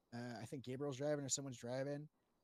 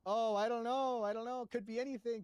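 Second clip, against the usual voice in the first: higher-pitched show of not knowing; mock cluelessness; 6 semitones higher, 3 dB louder than usual. Retelling their act for the panel, mock cluelessness. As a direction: play clueless in a raised voice. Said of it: "That's when I started doing the whole".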